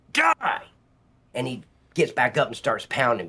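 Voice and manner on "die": retold threat. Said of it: "he's right here".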